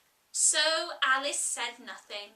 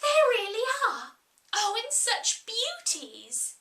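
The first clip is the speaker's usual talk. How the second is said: much higher, louder, thrilled cries; sudden delight; 9 semitones higher, 3 dB louder than usual. Acted. Alice spotting the scented rushes, high and thrilled. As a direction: burst out with childish joy. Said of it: "transport of delight".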